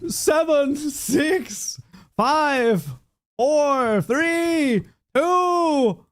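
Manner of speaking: high excited countdown shout; new year countdown crowd. Real, not acted. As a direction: count down to midnight, cheering.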